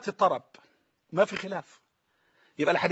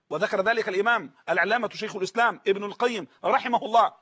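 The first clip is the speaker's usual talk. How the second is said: usual speaking voice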